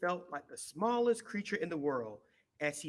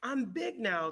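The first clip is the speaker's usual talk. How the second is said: higher, louder happy call; excited cry; 7 semitones higher, 4 dB louder, slower than usual. A narrator's reading. Little hippo runs past the elephant, crying out proudly. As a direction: shout it out with glee. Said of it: "cried Little Hippo".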